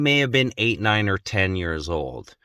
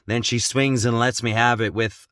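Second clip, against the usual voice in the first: normal talking voice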